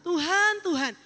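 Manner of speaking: loud, high, aggrieved demand; a cornered servant demanding his reward from God; demanding complaint. Acted. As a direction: insist loudly that your service counts. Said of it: "seperti keluar ya suara tuntutan".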